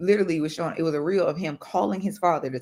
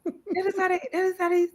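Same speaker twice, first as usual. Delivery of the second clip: husband explaining; 13 semitones higher than usual. Retold or imitated. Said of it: "He was like".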